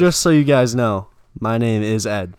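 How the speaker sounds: loud, proclaiming mock professor; mock professor announcement. Friend mocks the professor introducing himself, loudly.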